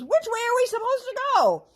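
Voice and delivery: high, loud goblin whine; lost goblin. A narrator's reading. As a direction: whine in panic.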